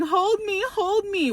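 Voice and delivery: put-on high whiny child begging; whiny toddler. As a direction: beg like a toddler on the hip. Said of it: "she's going".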